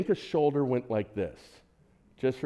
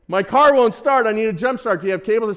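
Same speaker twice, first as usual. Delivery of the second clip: lightly voiced stranger; 8 semitones higher, 7 dB louder than usual. Voicing Festus stranger.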